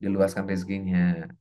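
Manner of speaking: low, subdued blessing; low, earnest supplication; silent-style prayer. Shown as a demonstration. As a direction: drop into a private prayer for a client. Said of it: "Kan kita bisa ngedoain".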